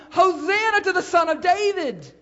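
high, loud acclaiming cry; crowd's shout. Retold or imitated. Preacher voicing the crowd greeting Jesus, loud joyful shout. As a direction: call it out like the cheering crowd. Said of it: "the crowds that followed were shouting".

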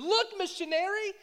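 high, loud, delighted cry; excited exclaim. Retold or imitated. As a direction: burst out with joyful discovery. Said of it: "he exclaimed".

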